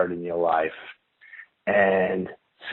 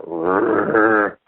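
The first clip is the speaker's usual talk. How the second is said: imitated growl. Imitating the growl heard between him and his friend, growling. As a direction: imitate a creature's rumbling growl. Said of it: "you can hear a growl".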